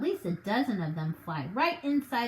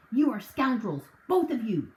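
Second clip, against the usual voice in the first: louder, clipped outrage at two scoundrels; angry shout; 2 semitones higher, 5 dB louder than usual. Acted. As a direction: shout the insult at them. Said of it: "shouted Mister Hazel".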